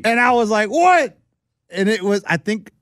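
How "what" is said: loud, high-pitched yelp of disbelief; shocked reaction. Retold or imitated. Dad hearing his kid is in the car, shocked. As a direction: blurt it out high and startled.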